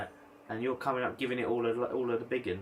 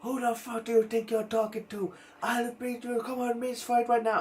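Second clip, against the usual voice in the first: furious high shout; 12 semitones higher than usual. Retold or imitated. Traveller woman turning on the smoker who refused, high furious shout. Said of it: "she flipped".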